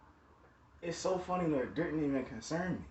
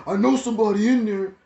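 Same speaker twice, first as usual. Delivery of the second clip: loud, higher-pitched holler; imitated yelling; 4 semitones higher, 11 dB louder than usual. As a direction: yell it as if through a closed door. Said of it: "yelling inside".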